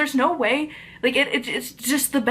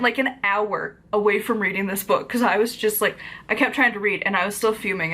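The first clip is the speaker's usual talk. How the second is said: normal talking voice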